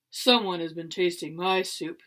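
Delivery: low, loud bear roar; roaring Papa Bear. A narrator's reading. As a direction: roar like a big angry bear. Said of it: "he roared".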